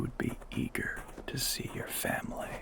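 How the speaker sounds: hushed aside to the listener; whisper. Acted. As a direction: whisper it so only she hears.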